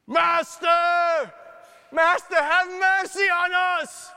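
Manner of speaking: high, loud desperate shout. Retold or imitated. Lepers shouting to Jesus from afar, loud plea. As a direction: call out across the distance for mercy. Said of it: "So we shouted at him".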